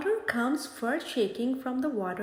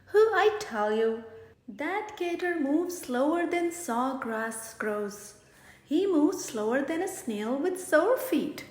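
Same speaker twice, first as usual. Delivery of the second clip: ordinary delivery, nothing marked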